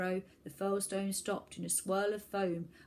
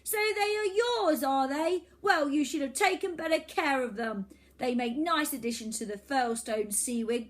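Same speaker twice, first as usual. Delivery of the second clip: put-on gloating villain boy; 6 semitones higher, 6 dB louder than usual. Boy villain gloats and demands the submarine, high and loud. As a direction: lord it over them.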